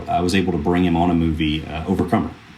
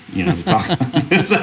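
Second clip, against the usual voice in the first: laughing; 11 semitones higher than usual. Real, not acted. Joking answer to serious question.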